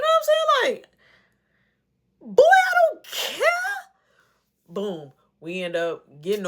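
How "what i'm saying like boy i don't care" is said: high loud squealing protest; exasperated outburst. Real, not acted. Venting to viewers about the brother, high shrieking exasperation. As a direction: shriek it like you've had enough. Aside exclaiming about being annoyed.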